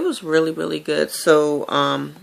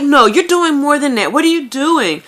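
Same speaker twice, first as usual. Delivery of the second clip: higher and a bit louder, insisting; pushy excited lady; 9 semitones higher, 4 dB louder than usual. Retold or imitated. Cafeteria lady insisting the vlogger is doing more, eagerly. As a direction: press her for the secret, eagerly. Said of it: "she's like".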